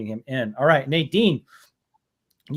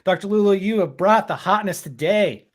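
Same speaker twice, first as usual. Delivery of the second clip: higher, louder and fast; gushing excitement; 4 semitones higher, 7 dB louder than usual. Real, not acted. Host reacting to the touching tribute, gushing fast and loud. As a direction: tumble it out, overwhelmed with delight.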